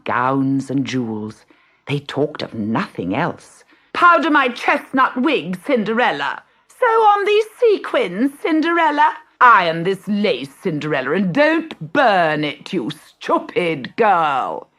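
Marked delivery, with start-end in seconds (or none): 3.9-14.7 high, loud spoiled-sister orders, slowing at the end; bossy stepsisters. Acted. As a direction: command her like a nasty little brat.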